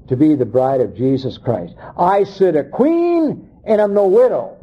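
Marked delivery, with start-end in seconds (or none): 1.9-4.6 lightly acted, higher-pitched boast; boastful queen. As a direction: mimic a queen's proud claim. Voicing the deceived bride's boast.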